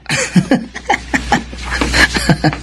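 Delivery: soft tipsy chuckle under the breath; quiet drunken snicker. Acted. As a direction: chuckle to himself, pleased with the wine. Tipsy farmhand enjoying the wine.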